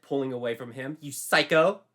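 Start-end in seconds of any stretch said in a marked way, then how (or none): 1.0-1.8 high, loud outraged shout; indignant shout. A narrator's reading. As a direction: snap at him. Pulling away angrily.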